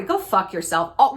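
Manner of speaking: normal talking voice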